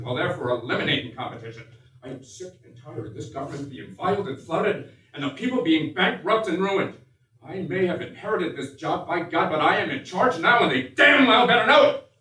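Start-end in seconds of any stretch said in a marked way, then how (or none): 2.0-12.1 building fury. Acted. Presidential tirade building to fury.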